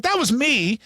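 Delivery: high, indignant correction; indignant surprise. Retold or imitated. Comic at the Comedy Store, correcting a stranger's boast, indignant and high. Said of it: "I was like".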